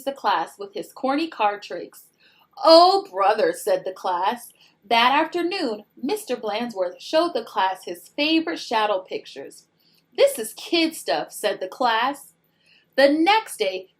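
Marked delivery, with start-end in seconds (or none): 2.6-3.5 loud, high exasperated groan; fed-up class sighing oh brother; bored groan. Acted. 10.1-11.3 high, dismissive scoff; bored class mocking the show; scoffing kids.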